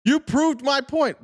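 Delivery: high, slow, loud mocking parrot; mocking dumb agent. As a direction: sneer it back slowly in a silly high voice. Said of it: "every time they open their mouths".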